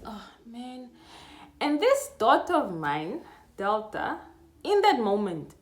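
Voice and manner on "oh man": a soft, defeated "oh, man"; worn-out groan of a word; weary "oh man". Retold or imitated. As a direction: sigh the "oh, man" out. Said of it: "I did this".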